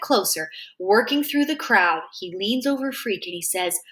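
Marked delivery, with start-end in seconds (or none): none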